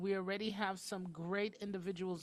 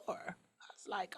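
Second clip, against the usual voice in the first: usual speaking voice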